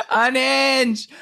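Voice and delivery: very high, laughing exclamation; shrieking with laughter. Real, not acted. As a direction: squeal it out through a real laugh. Cracking up at the [name] impression.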